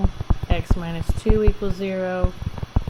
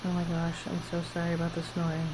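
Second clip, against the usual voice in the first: quiet aside; 9 dB quieter, much faster than usual. Real, not acted. Apologises aside for the snoring.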